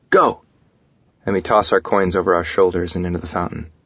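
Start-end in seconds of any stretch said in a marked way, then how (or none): 0.1-0.4 high, loud starting shout; yell. A narrator's reading. Husband starting the coin toss, quick yell. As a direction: yell it as the signal to throw. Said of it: "and then yell".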